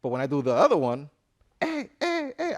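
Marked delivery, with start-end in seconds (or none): 1.6-2.5 going crazy. Shown as a demonstration. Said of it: "I go crazy".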